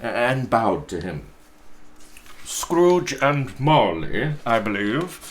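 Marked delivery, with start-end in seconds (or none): 2.5-5.2 gentleman's voice. Acted. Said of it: "said one of the gentlemen".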